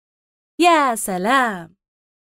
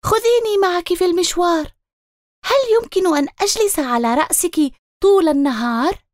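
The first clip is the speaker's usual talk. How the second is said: high, quick chirpy pleading; little bird voice; 6 semitones higher, faster than usual. Acted. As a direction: ask like an eager little bird. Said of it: "زقزق العصفور وطار".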